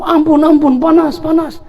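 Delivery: high, pleading voice of the jinn; mock jinn begging. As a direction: jinn in the possessed woman begs the preacher for mercy, high and pleading. Jinn begs for mercy.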